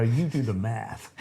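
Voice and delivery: quick put-on American accent, drawn out slowly; mock American accent, unhurried. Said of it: "why I did an American accent there".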